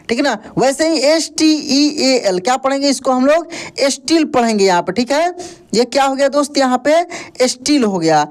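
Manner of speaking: usual speaking voice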